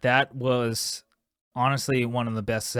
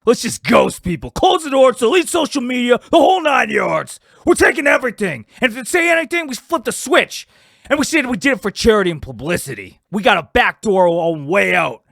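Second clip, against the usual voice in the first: much higher and louder, building to a shout; excited scheming rant; 9 semitones higher, 8 dB louder than usual. Acted. Partner lays out the getaway plan in an excited shout. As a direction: fire up the plan like a gleeful crook.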